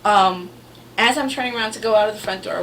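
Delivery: usual speaking voice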